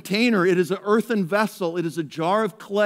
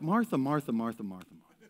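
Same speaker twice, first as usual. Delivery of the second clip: gentle rebuke; 5 semitones lower, 6 dB quieter, faster than usual. Retold or imitated. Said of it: "Jesus is like".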